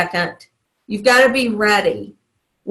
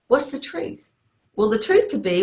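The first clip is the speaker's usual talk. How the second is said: usual speaking voice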